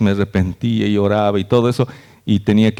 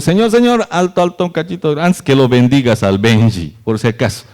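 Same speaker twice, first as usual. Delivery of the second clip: higher, urgent tattletale pleading; the enemy telling on Benji before God, much higher; accuser tattling; 5 semitones higher than usual. Retold or imitated. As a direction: tug at God's sleeve and snitch. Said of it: "Y va delante de Dios".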